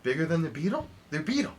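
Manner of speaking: high questions rising at the end; incredulous questioning. Acted. Enthralled listener asking how big the terrorkit was, incredulous questioning. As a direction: repeat it in growing disbelief.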